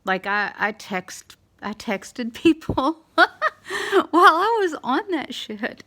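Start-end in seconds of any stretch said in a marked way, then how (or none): 1.6-5.8 laughing through the words; high, loud laughing. Real, not acted. Laughing over sedation story.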